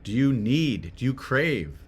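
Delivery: normal talking voice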